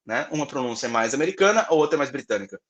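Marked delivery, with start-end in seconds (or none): none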